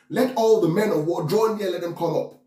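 high, ringing war summons; declaimed summons. Acted. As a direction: proclaim it high, like rallying troops.

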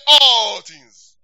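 loud call-and-response. Real, not acted. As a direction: belt it for them to echo. Congregation prompted to repeat.

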